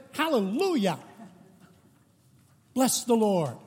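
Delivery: Pentecostal outburst. Retold or imitated. Pentecostal lady interrupting the Presbyterian service, joyful cry. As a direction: burst out in praise like a Pentecostal lady in church. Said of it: "and the lady jumped up at one point and said".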